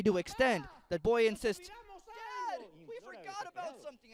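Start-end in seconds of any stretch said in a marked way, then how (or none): 1.7-4.1 put-on high, soft desperate child's plea; imploring child. Bound son begging his father, high and desperate. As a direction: beg your dad in a small high voice. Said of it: "he implores desperately".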